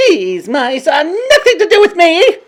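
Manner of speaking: high, loud witch denial; scornful shout. A narrator's reading. Grand High Witch denying the mice, loud shout. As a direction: fling the denial at them. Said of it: "she shouted".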